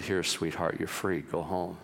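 low, soft, gentle release; tender voice freeing the girl. A narrator's reading. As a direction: speak to her like a kind father. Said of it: "and said".

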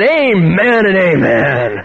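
slow, stretched-out closing amen; mock big preacher's sign-off, drawn out; imitated unrestrained prayer. Tom ending his long public prayer, imitated grand amen. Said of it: "He didn't know how to pray in a soft tone".

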